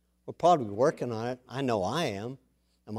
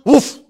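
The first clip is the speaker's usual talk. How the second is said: loud, high imitated woof; short high-pitched woof; dog bark; 10 semitones higher, 12 dB louder than usual. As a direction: bark a sharp answer. Voicing the dog's answer.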